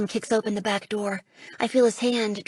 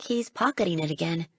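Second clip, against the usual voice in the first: ordinary delivery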